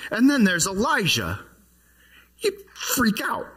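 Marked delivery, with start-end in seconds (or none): 2.4-3.5 mock panic. Imagining disciples' panic.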